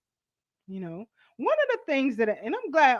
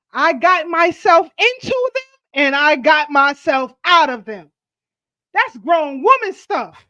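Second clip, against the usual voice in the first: loud, slow and emphatic; slow, loud, proud declaration; 3 semitones higher, 8 dB louder than usual. Real, not acted. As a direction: hammer it home with pride.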